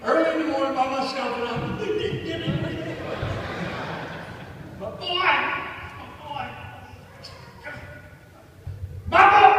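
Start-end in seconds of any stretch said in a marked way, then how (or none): 1.7-4.8 joyful laugh. Real, not acted. Father overjoyed after the call. 4.8-9.5 joyful cry. Real, not acted. Proud father alone at home, crying out about his son in overjoyed pride.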